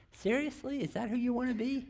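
high, soft, disbelieving; incredulous self-questioning. Retold or imitated. Father glimpsing his own harshness, incredulous self-questioning. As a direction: challenge yourself quietly with lifted pitch. Retells challenging himself.

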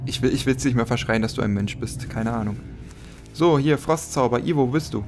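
usual speaking voice

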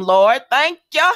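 loud, rising "thank ya" of relief; exclaimed relief. Acted. Recalling first semester away from home, exclaimed relief. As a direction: shout your thanks, playfully.

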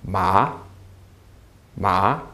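"Ma, ma" each rising from low, like surprise; questioning upward "Ma, ma"; rising second tone. Shown as a demonstration. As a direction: lift the pitch through each "Ma, ma". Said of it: "your voice is rising".

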